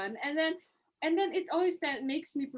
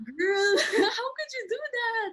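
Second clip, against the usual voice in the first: laughing disbelief; 12 semitones higher, 6 dB louder than usual. Retold or imitated. Retold late-night reaction to classmates.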